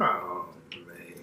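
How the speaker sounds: breathy whispered letdown; whispered disappointment. Real, not acted. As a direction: whisper it, deflated. Disappointed about the pie.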